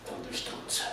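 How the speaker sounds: hissed under the breath; whispered insult. Acted. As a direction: whisper it bitterly. Lui insulta sottovoce. (insulto sussurrato)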